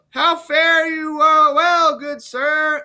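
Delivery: high, slow, distant call as if from afar; voice far away. Acted. Edgar faking distance to fool blind Gloucester, far-off call. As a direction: throw the voice so it seems far off. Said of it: "he makes it sound like his voice is far away".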